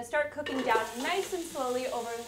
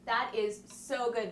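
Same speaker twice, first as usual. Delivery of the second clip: savoring; slower than usual. Real, not acted. Savoring first taste of soup.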